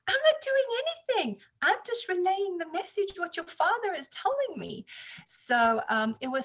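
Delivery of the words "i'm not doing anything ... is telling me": excited protest. Retold or imitated. Her reply to the amazed neighbor.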